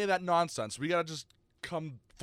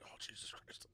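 pained whisper; 22 dB quieter than usual. Retold or imitated. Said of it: "I'm like".

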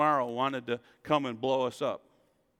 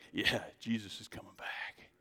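mock carefree whisper; 5 semitones lower, 5 dB quieter than usual. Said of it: "We'd be like".

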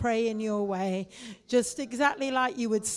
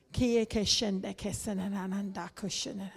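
praying in tongues; 3 semitones lower than usual. Real, not acted. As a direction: murmur it low in the Spirit.

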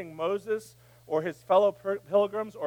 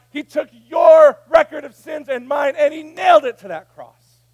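preaching at full force; 8 semitones higher, 14 dB louder than usual. Acted. Pastor telling congregation sins are nailed, loud full-force preaching. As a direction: drive it home from the pulpit. Sermon climax on forgiveness.

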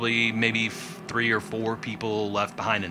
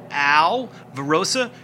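indignant Rickety; 3 semitones higher, 6 dB louder than usual. Acted. Angry NPC banishes player.

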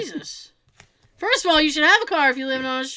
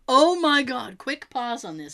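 ordinary delivery

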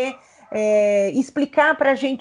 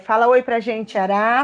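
ordinary delivery

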